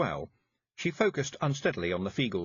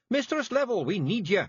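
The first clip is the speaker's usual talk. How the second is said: high Scots shout into her ear; high, loud Feegle shout; 11 semitones higher, 5 dB louder than usual. A narrator's reading. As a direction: call her back urgently. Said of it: "shouted Rob Anybody".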